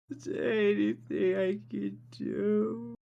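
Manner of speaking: high, slow, blubbering plea; hammed-up weepy girl, high and slow; ugly crying. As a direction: sob the question out through tears. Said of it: "just ugly crying".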